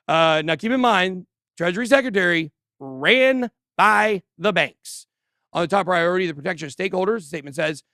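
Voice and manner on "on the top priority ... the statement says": usual speaking voice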